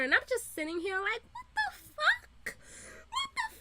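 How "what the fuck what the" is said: shocked disbelief. Retold or imitated. Fan reacting to the burned laptop, shrieking disbelief. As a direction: squeak it out in comic outrage.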